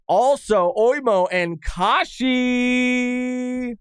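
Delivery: drawn-out, high shouted reveal; hyped announcer-style reveal. Real, not acted. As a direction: stretch the last name into a big, high holler. Hyped reveal of two names.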